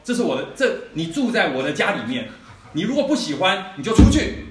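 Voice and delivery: 越说越大声的怒吼; 摆出父亲威严把孩子赶出门; angry shout. Retold or imitated. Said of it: "我就说". (父亲越说越响地把孩子赶出门)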